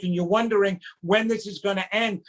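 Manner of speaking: ordinary talk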